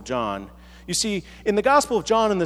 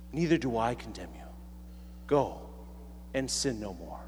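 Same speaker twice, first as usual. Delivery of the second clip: matching her soft tone; 9 semitones lower, 11 dB quieter than usual. Retold or imitated. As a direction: lower and much softer, gently bending down to her level. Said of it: "Jesus matches her pitch, matches her tone".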